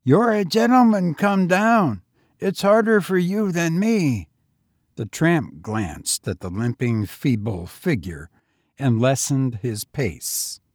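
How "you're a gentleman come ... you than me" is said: higher, youthful lad's voice; street boy. A narrator's reading. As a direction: play the lonely young tramp, higher. Boy's voice.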